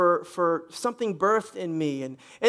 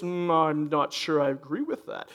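low, disapproving religious tone; Micah; 6 semitones lower, 4 dB quieter than usual. Acted. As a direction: mimic a rule-keeper's reserved disapproval in a lowered voice. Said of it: "she had a very religious, rule-keeping, you know, tone and attitude".

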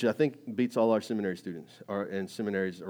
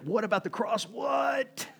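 amazed outcry; 12 semitones higher, slower than usual. Retold or imitated. New believer discovering the cross, astonished shout. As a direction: blurt it out like a stunned new believer.

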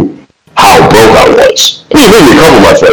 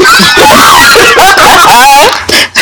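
real laugh. Real, not acted. Laughing at his mom's joke about his name, real laugh.

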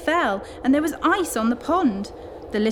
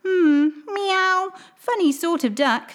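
much higher musing meow; cat; 6 semitones higher, slower than usual. Acted. Old cat judging the duckling, musing. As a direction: purr it out like a doubting cat. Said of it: "said the cat".

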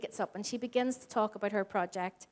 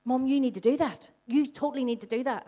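son insisting; 3 semitones higher, 5 dB louder than usual. Retold or imitated. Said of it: "and I'm like".

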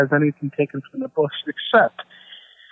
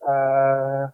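heavy breathy sultry sigh; sultry narrator; 2 semitones lower, 5 dB quieter than usual. Pretend. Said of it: "We didn't ask for heavy breathing".

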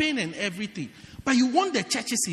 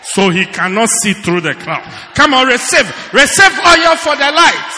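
hammed-up shouted vision; mock prophetic shouting; 9 dB louder than usual. Pastor imitating a shouting prophet to his church. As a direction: bellow like an over-the-top prophet. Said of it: "Lord, you say".

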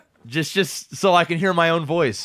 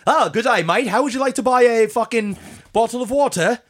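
put-on higher, wobbly Australian-Cockney accent; Australian-turned-Cockney accent; 4 semitones higher than usual. Voice actor mimics a shopkeeper game character, muddled Australian-Cockney accent. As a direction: slide from Australian into bad Cockney. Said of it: "like, a Cockney accent".

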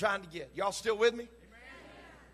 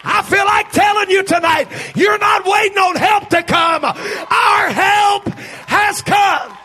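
shouted sermon climbing higher and louder; full-force preaching, building; 11 semitones higher, 15 dB louder than usual. Real, not acted. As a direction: build to a high, roaring preacher's climax.

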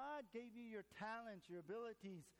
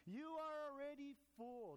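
sarcastic Paul; 6 semitones higher than usual. Acted. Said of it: "Paul goes on a little sarcastically".

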